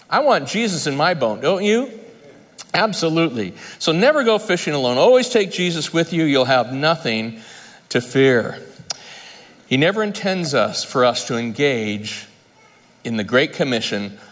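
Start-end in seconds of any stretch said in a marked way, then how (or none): none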